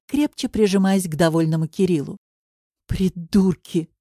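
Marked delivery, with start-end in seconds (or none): 2.8-3.9 slow, drawn-out insult; weary, stretched-out contempt; exhaled exasperation. A narrator's reading. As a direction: breathe it out slowly, fed up. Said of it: "выдохнула Вика".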